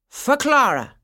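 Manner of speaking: high, loud, grand presentation; with a flourish. A narrator's reading. Godfather presenting a final gift, with a flourish. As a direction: present it proudly at full voice. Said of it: "he said with a flourish".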